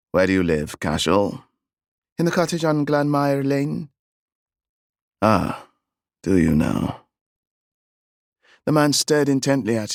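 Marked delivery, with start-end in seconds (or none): 0.1-7.1 a tall Englishman questioning; English gentleman. A narrator's reading. Stranger questions the boy.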